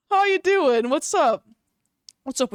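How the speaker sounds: high, loud, overdone check-in; mock concerned greeting. Streamer teasing her co-host, hammed-up greeting. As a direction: ham up asking if he's okay.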